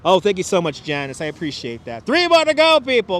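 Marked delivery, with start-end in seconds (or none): 2.1-3.2 high, loud countdown call; excited, raised-voice rallying cry; rallying shout. Real, not acted. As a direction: holler it to the whole crowd. Rallying viewers towards like target.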